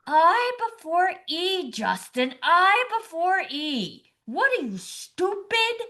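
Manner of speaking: high, drawn-out scolding yell; a mock scream climbing on the last word. Spelling bee spectator bursts out at Justin, screaming. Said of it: "and starts screaming".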